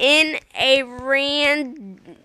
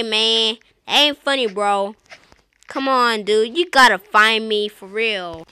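usual speaking voice

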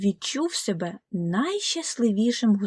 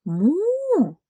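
a high, loud mock moo; higher cow moo; 8 semitones higher, 3 dB louder than usual. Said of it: "сказали корови".

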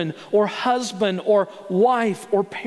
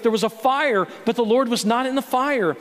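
usual speaking voice

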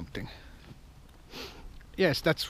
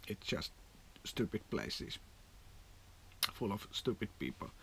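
muttered scorn; 6 semitones lower, 16 dB quieter than usual. Real, not acted. Muttered aside about Finnish bars.

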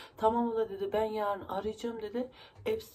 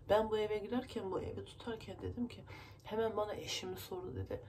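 ordinary delivery

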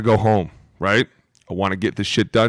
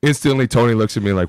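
ordinary talk, nothing marked

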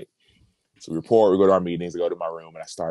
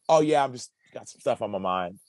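higher, offhand excuse; lying brush-off; 4 semitones higher than usual. Retold or imitated. Player lying to a concerned teammate, falsely casual. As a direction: wave the worry away casually. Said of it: "I would lie".

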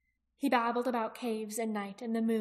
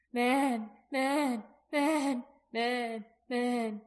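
mild wail, word after separate word; repeated wail; 2 semitones higher, 3 dB louder, much slower than usual. A narrator's reading. Frightened dragon's sending to Jaqen, repeated mild wail. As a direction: wail the word over and over, a little raised. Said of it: "It was a kind of wail".

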